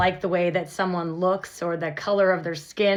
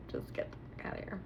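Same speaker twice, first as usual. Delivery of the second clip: whispered curse; 17 dB quieter, faster than usual. Acted. Vlogger telling hateful people to leave, fast whisper.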